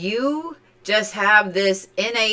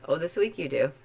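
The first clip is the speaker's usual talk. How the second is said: Oklahoma accent; 4 semitones lower, 7 dB quieter, faster than usual. Real, not acted. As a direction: let the Oklahoman come out. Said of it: "I really got a bad accent there".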